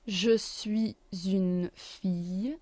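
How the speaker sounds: "suis une" linked with a z sound, said slowly; liaison. Shown as a demonstration. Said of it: "There's a liaison".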